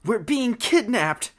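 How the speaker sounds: high, loud, drawn-out cry; alarmed shout. Acted. Robin realizing the birds are taken, shouting alarm. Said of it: "I shouted".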